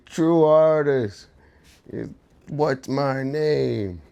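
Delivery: hammed-up unhinged stranger demanding attention; retelling the deranged customer, high and slow; deranged guy imitation. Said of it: "the guy was deranged".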